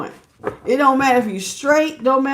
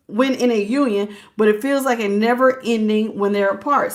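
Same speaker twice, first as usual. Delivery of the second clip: ordinary delivery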